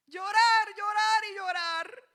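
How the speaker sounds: high, loud mock wail of llorar; loud, hammed-up crying voice. Mock crying after diagnosis.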